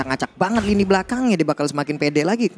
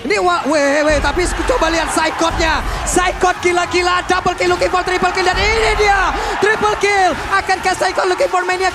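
fighting game announcer; 9 semitones higher than usual. Real, not acted. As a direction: call the teamfight at full energy. Live hype play-by-play of teamfight.

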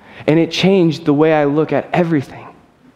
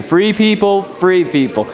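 louder call; 6 semitones higher, slower than usual. Shown as a demonstration. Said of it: "Let's try it again".

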